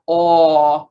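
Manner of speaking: usual speaking voice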